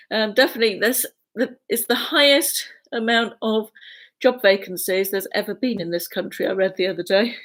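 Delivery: normal talking voice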